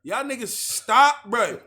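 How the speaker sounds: loud stop. Real, not acted. Friends goofing, telling them stop.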